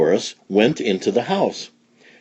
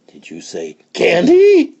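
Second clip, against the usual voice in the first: high, eager question; 9 semitones higher, 5 dB louder than usual. A narrator's reading. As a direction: perk up at the word candy. Morris voiced by reader.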